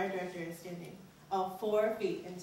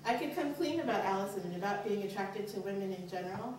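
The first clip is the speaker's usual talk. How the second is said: plain reading voice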